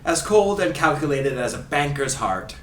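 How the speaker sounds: theatrical fake Scottish commentator; Ray Hudson Scottish accent. Host reading a quote for guessing, in fake Scottish accent. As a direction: deliver it in a put-on Scottish accent. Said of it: "through my fake Scottish accent".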